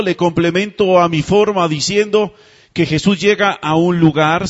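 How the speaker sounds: usual speaking voice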